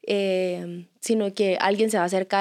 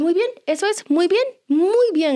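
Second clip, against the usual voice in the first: encouraging praise; 8 semitones higher, 3 dB louder than usual. Retold or imitated. Mom praising daughter as she smiles through tears, high encouragement. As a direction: coo approval to a small child.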